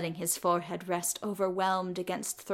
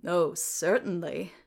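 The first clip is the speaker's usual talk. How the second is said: smirking. A narrator's reading. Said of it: "smirks Thorin".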